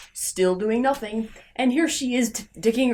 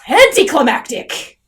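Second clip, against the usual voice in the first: louder, blurted out in frustration; exasperated outburst; 3 semitones higher, 8 dB louder than usual. Real, not acted. Reviewer summing up the ending, loud outburst. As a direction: bark the verdict in disgust.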